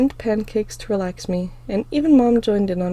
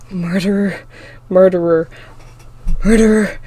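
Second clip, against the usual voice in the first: louder, rising chant of an accusation; accusing voices grow loud; 8 dB louder than usual. A narrator's reading. Tormenting voices crowding in on the frightened girl, rising. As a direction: let the accusing voices swell.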